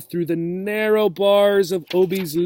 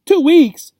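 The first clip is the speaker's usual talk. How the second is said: much higher, louder shocked cry; aghast; 12 semitones higher, 10 dB louder than usual. Acted. Obie aghast at the family's long absence. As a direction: gasp it out in dismay. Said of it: "cried Obie, aghast".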